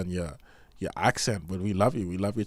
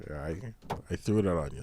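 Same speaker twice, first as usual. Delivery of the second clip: normal talking voice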